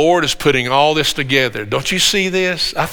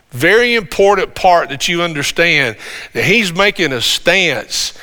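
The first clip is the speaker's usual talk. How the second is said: ordinary talk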